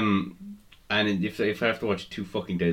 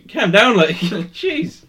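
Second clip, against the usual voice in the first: high, loud laughing plea; laughing protest; 12 semitones higher, 9 dB louder, much slower than usual. Real, not acted. Real laughing plea to his over-excited co-host. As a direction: cry calm down through laughter. Reacting to the impression.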